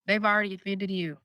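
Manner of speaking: ordinary delivery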